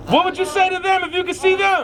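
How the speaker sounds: high, projected speech over chanting; rally call. Real, not acted. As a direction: call out over a singing crowd. Calls out over the crowd's chant.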